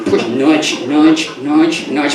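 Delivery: ordinary delivery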